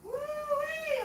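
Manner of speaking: high excited woo; excited whoop. Real, not acted. Excited cooking intro.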